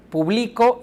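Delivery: publicó said as pu-BLI-co, weight in the middle; stress on middle syllable. Shown as a demonstration. Teacher contrasting stress patterns, stressing the middle of publicó. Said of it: "En la segunda está en medio".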